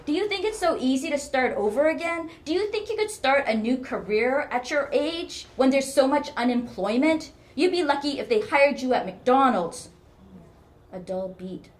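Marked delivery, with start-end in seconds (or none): 0.1-9.9 loud, high, scornful challenge; scoffing. A narrator's reading. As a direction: pour scorn on the idea. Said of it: "Her mother scoffed".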